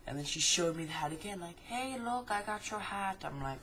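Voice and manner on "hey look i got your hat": high-pitched imitation of a taunting old woman; mock taunting old lady. Old lady showing off a stolen hat, mock taunt. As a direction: tease in a put-on high voice.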